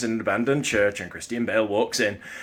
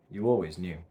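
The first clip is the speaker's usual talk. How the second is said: low, very soft, resigned film monologue; hushed and deep, with long heavy pauses; doomed man's monologue; 9 semitones lower, 23 dB quieter than usual. Acted. Performs Equilibrium monologue to camera.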